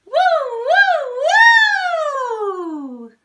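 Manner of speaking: very high loud siren woo. Shown as a demonstration. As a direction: go as high as you can, then slide down. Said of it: "this time Pie's gonna join us".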